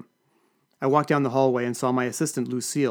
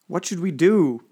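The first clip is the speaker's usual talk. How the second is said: moaning; 5 semitones higher, 5 dB louder, slower than usual. Acted. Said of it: "moaned a trade commissioner".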